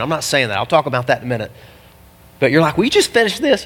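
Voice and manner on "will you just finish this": raised-pitch mock impatience; higher, pushy heckle; impatient listener. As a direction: butt in as a fed-up listener. Voices an impatient listener to himself.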